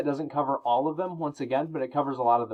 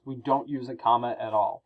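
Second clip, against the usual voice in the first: ordinary delivery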